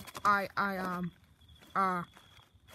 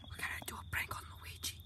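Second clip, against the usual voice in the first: whispering; 12 dB quieter than usual. Acted. Secret plan told to friend.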